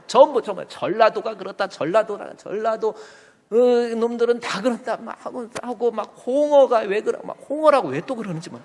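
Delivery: high, heated mock rant; imitating online ranters. Mimicking regional insult comments online.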